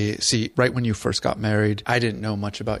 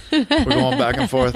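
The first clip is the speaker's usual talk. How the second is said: laughing; 5 dB louder than usual. Real, not acted. Laughing while describing playlist.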